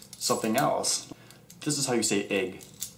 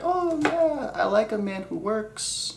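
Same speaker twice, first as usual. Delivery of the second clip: high, slow mock girl's voice; girly voice; 12 semitones higher, slower than usual. Girl voice in skit.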